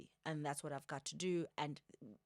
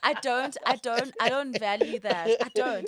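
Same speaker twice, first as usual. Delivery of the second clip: laughing; 5 semitones higher, 15 dB louder than usual. Real, not acted. Laughing after friend's joke.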